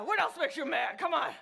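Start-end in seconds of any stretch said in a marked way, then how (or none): none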